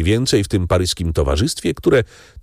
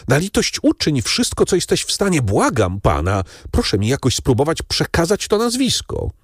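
much higher, urgent imploring plea; desperate begging; 7 semitones higher than usual. A narrator's reading. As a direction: beg the old man with everything you have. Franz begs Noirtier for the name.

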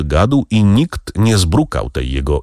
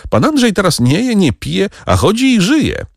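playful singsong tease, much higher; charming teasing; 14 semitones higher than usual. A narrator's reading. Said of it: "uśmiechnęła się wdzięcznie".

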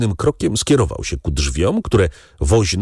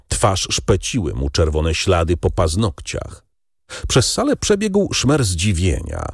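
ordinary delivery, nothing marked

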